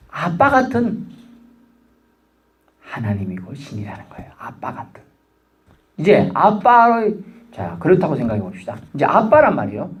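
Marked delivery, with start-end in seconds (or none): 2.8-5.0 sudden intimate whisper. Real, not acted. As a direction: drop the voice to a hush, as if telling a secret. Preacher drops to intimate whisper.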